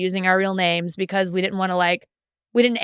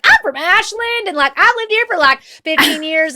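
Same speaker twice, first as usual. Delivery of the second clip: loud proud imitation; 10 semitones higher, 7 dB louder than usual. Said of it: "now you're like".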